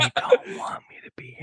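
breathy whispered imitation of a scared friend; mock whisper. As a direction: whisper it back as a tease.

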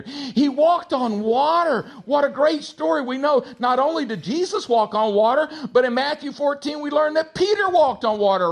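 high, loud, impassioned sermon delivery; full-force preaching. Real, not acted. Preacher urging the congregation about Jesus's power, full-force preaching. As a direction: preach it out at the top of your range.

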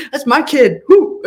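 loud, high whoop of mock parental pride; proud cheering. Proud parent cheering a child who stood up, jubilant. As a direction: whoop it up, laughing. Cheering the kid's fight, laughing.

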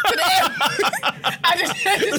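laughing. Real, not acted. Explaining her joke, cracking up.

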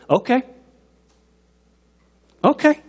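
high, rising, ominous okay; warning dad. Retold or imitated. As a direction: act a father who has had enough. Acts exasperated dad's one-word warning.

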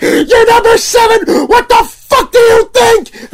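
very high, drawn-out screaming shout; furious yell. Retold or imitated. Vendor with fists on table to customer, furious yell. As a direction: scream it slowly, acting out lost temper.

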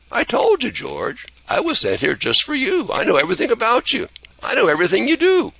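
put-on high, quick old-angel voice; an amused angel reciting George's life; lively high angel Clarence; cheery old angel.

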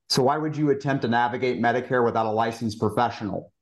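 ordinary delivery

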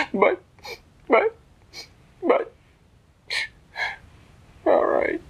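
high, tearful stammer; stammer, pause, then give in with a whimper; squeaky crybaby bear; whimpering Sad Bear. Acted. Sad Bear gives in reluctantly.

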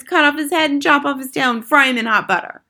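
high, loud bloodthirsty chant; cried. A narrator's reading. Witches egging on their leader, crying out. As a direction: call it out gleefully, high and loud. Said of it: "cried the witches".

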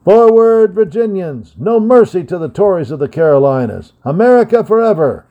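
high, loud rallying shout over gunfire; rally the troops above the din; battle cry. Acted. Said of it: "Rodney's voice was heard above the din".

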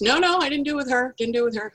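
put-on high childish denial; little boy. Altar boy denying to the priest in a high child voice. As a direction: protest like a nervous kid. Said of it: "And the little boy goes".

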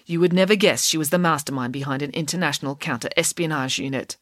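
ordinary delivery

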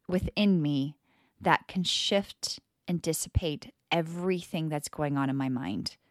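ordinary talk, nothing marked

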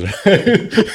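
short bright burst of laughter; amused laugh. Real, not acted. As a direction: laugh at the surprise. Laughing at raisins smell.

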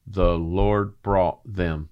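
"The Lord brought them" said slowly, each word weighted, "Lord" stressed; slow down and lean on "Lord" in "The Lord brought them"; stressed slow phrase. Shown as a demonstration. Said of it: "listen to what you just said".

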